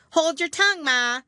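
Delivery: high, loud snappy retort; snappish young crab. A narrator's reading. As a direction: snap back at mother. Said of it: "a little snappishly".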